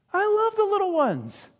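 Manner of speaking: high-pitched doting declaration; gushing affection. Acted. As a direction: coo it high with delight. Jesus repeats it, much higher.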